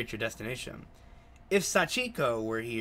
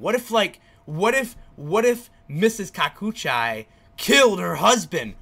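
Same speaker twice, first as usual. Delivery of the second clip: excited; 6 semitones higher, 9 dB louder than usual. Real, not acted. Excited murder theory.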